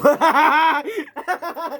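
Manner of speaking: high, loud helpless laugh bursting out; burst of laughter. Real, not acted. To his friend, laughing at himself, a real laugh. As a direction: crack up at your own mistake. Mistakes street light for star.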